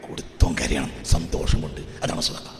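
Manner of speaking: low, husky near-whisper; hushed preaching. Real, not acted. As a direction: drop to a confiding hush for the congregation. (പതിഞ്ഞ സ്വരത്തിലുള്ള ഉപദേശം)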